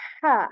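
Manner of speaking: ha said as a clear throat H with short a; fatha ha. Shown as a demonstration. Teacher showing the learner the clear ha. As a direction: say ha from the throat near the chest, then a. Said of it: "we say".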